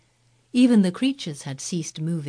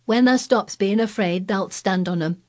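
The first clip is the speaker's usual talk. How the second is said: higher, louder Yorkshire encouragement; cheerful Yorkshire; 3 semitones higher, 4 dB louder than usual. Acted. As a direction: cheer him on, Yorkshire lad. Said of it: "with renewed cheer".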